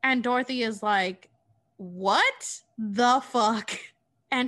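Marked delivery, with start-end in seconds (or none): 1.8-3.7 very slow, loud mock outrage; shocked disbelief. Dorothy sees her friend on fire, shocked. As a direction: stretch out each word in disbelief.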